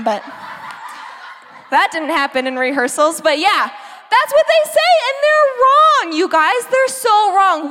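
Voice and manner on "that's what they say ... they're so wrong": much higher pitch, insisting they're wrong; excited protest. Real, not acted. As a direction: rise up high and insist, half laughing. Excitedly refuting the doubters.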